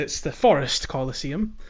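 ordinary talk

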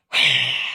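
big exasperated sigh pushed out; heavy frustrated sigh. Shown as a demonstration. Showing the radio hosts dad's frustrated heavy sigh. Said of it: "I do the sigh".

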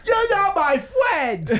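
put-on high, slow sulky kid voice; whiny child. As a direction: pout like a hurt kid.

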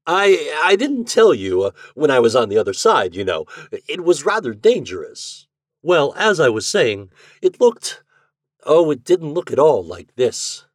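high, loud, stammering excuses; caught-out husband blustering on; flustered. A narrator's reading. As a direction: cover up a slip, stumbling over the words.

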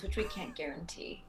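hushed wry aside under the breath; whispering. Acted. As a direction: whisper it as a dry afterthought.

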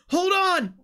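high, loud desperate scream. Acted. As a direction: scream it over the chaos. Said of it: "Noodle continues screaming".

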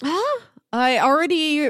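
usual speaking voice

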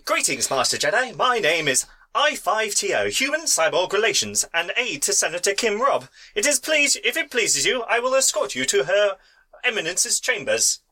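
high, fussy robot butler; prim high droid voice; protocol droid voice. Acted. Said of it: "Like the very C three P O man".

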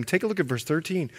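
ordinary talk

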